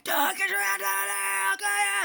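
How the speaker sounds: shrill-free hammed-up cop yell; mock shouting cop. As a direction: bark it like a panicked cop. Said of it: "he's screaming".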